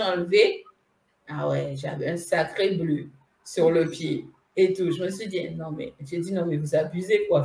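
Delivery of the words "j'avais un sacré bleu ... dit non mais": normal talking voice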